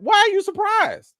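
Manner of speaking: high, loud, exasperated demand; exasperated shout. Real, not acted. Vlogger shouting at the actress over her fake surprise, exasperated. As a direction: shout the question back at her in disbelief. Challenging a celebrity's feigned surprise.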